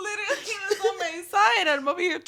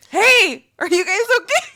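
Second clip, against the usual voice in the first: calling out; 4 semitones higher, 11 dB louder than usual. Retold or imitated. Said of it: "I just hear".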